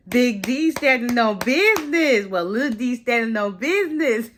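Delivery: high, singsong cheering him on; playful hype. Real, not acted. Hyping Devin for standing up, playful and high. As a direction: hype him up, pitched well up.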